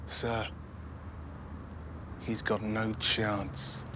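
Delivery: soft, slow, weighted protest; quiet, drawn-out doubt; subdued objection. Acted. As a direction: drop right down and object gravely. Argues after outburst.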